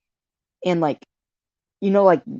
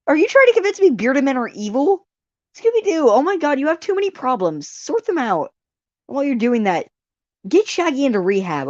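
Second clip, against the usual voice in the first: mock scolding; 8 semitones higher than usual. Scolding the show playfully.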